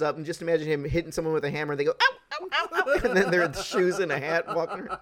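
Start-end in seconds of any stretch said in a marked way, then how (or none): 1.9-2.9 very high, silly cries of pain; comic squeaky "ow" imitation; mock cartoon yelps. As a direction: yelp like a bonked cartoon character. Imitates cartoon victims being hammered.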